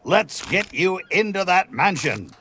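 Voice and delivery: ordinary talk, nothing marked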